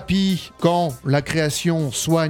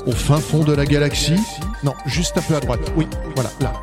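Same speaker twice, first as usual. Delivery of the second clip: dramatic trailer narration over music; announcer intro. Acted. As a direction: announce it like an epic film trailer.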